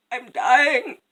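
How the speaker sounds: hammed-up deathbed complaint; dramatic dying queen. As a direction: announce your death with mild melodrama. Queen feigns illness on bed.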